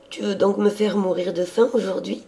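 high-pitched, hungry sister complaining; playful younger sister. A narrator's reading. Sister pleading hungrily with her priest brother, high and lively.

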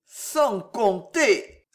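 put-on slow, drawn-out drunken mumble; halting tipsy delivery; stammering. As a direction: falter over each word, very slowly. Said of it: "balbutia Gillot".

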